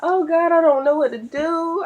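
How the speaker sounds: high, tearful retelling of the pain; retold crying. To her mother-in-law on the phone, high tearful voice. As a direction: relive the hurting, voice up and near tears. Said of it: "I was crying".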